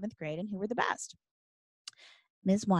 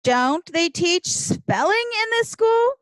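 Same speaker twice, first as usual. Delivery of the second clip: slow, loud, high-pitched indignation; outraged; 10 semitones higher, 6 dB louder, slower than usual. A narrator's reading. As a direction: stretch it out in loud disbelief. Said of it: "Joe is outraged".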